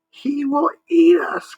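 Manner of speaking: very high, loud, slow panicked cry; frightened cry. Acted. Marsman fearing the monster, high scared cry. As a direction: wail it in fear. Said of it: "cried the Marsman".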